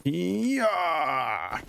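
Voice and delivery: long "hee-yah" swooping up high, then falling; battle cry. Pretend. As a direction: draw out a swooping attack cry. Battle cry during attack in game.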